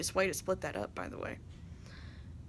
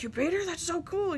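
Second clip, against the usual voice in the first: higher, bright surprised praise; excited friend; 9 semitones higher than usual. Retold or imitated. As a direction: run in thrilled by the news.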